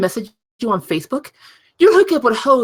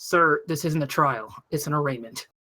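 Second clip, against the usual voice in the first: stern judge; 8 semitones lower, 5 dB quieter than usual. Acted. Judge corrects defendant.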